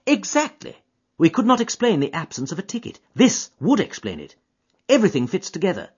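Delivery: keen detective to his friend; Holmes voice. Acted. Holmes to Watson on the train, eager and keen.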